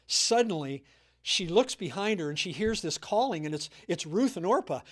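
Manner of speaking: usual speaking voice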